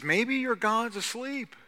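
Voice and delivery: high, slow mock suggestion; needling, sing-song pitch; prophet's taunt. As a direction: tease them with a drawn-out, lifted voice. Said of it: "But he says".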